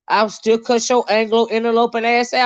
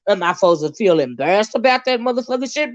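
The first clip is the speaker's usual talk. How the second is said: ordinary delivery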